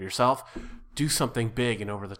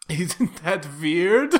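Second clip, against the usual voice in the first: loud, drawn-out mock Dutch accent; hammed-up Dutch movie villain, rising at the end; Goldmember; 5 semitones higher, 7 dB louder, much slower than usual. Improviser quoting a silly film, mock Dutch accent. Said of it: "Gold member?".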